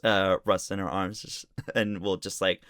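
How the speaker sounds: usual speaking voice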